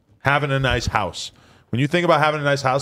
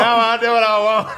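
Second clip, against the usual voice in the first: laughing; 10 semitones higher, 6 dB louder than usual. Retold or imitated. Repeats boast laughing.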